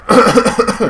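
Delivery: loud choking cough; hacking cough, high and loud; coughing fit. Real, not acted. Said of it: "but I'm choking".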